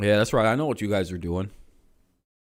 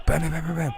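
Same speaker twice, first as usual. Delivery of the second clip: quick, higher-pitched bam-bam-bam; mimed flurry of blows; rapid mock punch sounds; 5 semitones higher, 4 dB quieter, faster than usual. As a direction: rattle off pretend punches in a rush. Acting out a beating.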